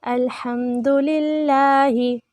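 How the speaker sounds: slow, measured chant with long held vowels; Quranic recitation. Shown as a demonstration. Teacher recites for students to repeat, slow chant. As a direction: intone each phrase and stretch the endings.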